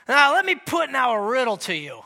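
boastful tipsy Samson. Acted. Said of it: "imagine he's slurring his speech".

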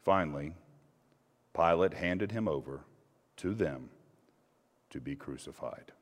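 low, fading, soft close; solemn hush. A narrator's reading. Reader closes the passion, low and fading to a hush. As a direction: let it sink to quiet grief.

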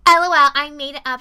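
loud, brazen prank reveal; mock gleeful confession. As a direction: imitate a prankster gloating. [name] gleefully owning up to prank.

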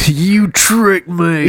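long, drawn-out high accusation; hammed-up fooled victim; mock betrayal. Acts out outraged fooled person.

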